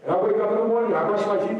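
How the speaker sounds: ordinary talk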